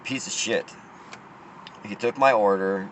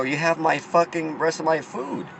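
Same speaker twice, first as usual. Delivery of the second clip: higher, heated outburst about the food; raised mock yell over the missing order; angry demand; 7 semitones higher than usual. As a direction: snap it at him, indignant. Demanding his food back, retold.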